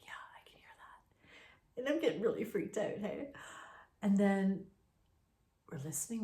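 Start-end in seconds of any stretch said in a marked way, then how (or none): 0.0-1.8 slow, voiceless, barely there; frightened whisper. Retold or imitated. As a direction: breathe it out terrified. Frightened answer in the dark.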